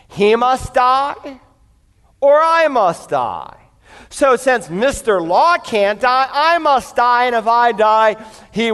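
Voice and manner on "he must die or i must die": slow, weighty stress on die and I; slow emphatic. Acted. Preacher spells out the only escape, slow and emphatic. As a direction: spell out each word gravely. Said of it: "only two ways out".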